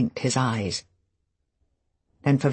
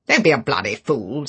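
a rough, louder snap; 6 dB louder than usual. Acted. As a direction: bark at him to stop pretending. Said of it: "said Favell roughly".

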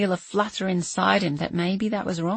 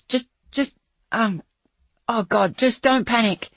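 fearful; 4 semitones higher, 4 dB louder than usual. Acted. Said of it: "unable to keep the fear out of my own voice".

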